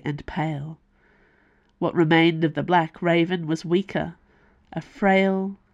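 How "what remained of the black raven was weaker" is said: usual speaking voice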